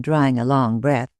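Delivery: usual speaking voice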